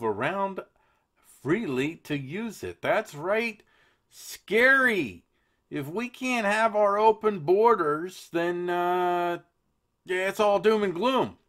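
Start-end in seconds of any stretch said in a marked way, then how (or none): 2.8-11.4 high, loud mock horror; mock alarm. Host mocks the EU's warning, hammed-up fright. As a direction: ham up the doom like a scary story.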